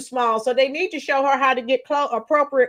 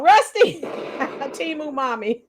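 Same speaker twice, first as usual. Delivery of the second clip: laughing through the name; slower than usual. Real, not acted. Reactor laughing at the mother in the clip. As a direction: crack up while saying it.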